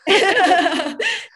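high, loud real laugh; burst of laughter. Real, not acted. Host laughing at the guest's "bring it on", real laughter.

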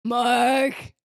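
drawn-out zombie call; arguing zombie. Acted. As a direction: moan the name out like an undead arguer. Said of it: "these two zombies staring at each other".

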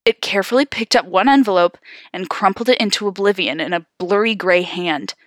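ordinary delivery, nothing marked

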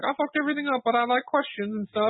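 hammed-up, very high villain parody; Riddler. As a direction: squeal it like a silly caged villain. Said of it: "Riddler's in his little cage".

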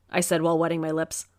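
ordinary delivery, nothing marked

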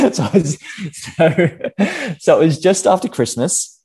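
amused recovery in own voice; laughing. Real, not acted. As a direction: get back to the story while laughing. Laughing after dropping the American accent.

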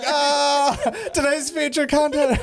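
lightly mocking, high-pitched flustered imitation; high teasing impression, breaking into laughter; Kevin. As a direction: mimic a caught-out co-host, pitched high. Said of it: "he's just like".

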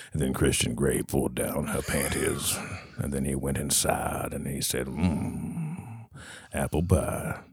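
low, soft seductive read; sultry voice. Pretend. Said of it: "Get my nice sultry voice here".

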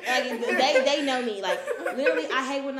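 loud, laughing talk; real loud laughter. Real, not acted. Laughing while talking.

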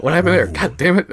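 startled, laughing curse as if you just hit the mailbox; mock startled outburst. Joking about mowing while listening, startled shout. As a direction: play it like you just mowed over something, mock panic.

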